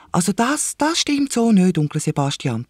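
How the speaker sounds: put-on high child voice objecting; protesting little girl. A narrator's reading. Lisa contradicting her uncle, high and protesting. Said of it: "protestiert sie".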